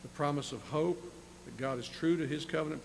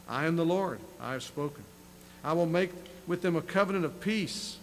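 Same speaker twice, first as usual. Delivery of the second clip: louder proclamation of God's words; scripture reading; 3 semitones higher, 4 dB louder than usual. Acted. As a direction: proclaim it like the Lord speaking. Preacher reads scripture aloud.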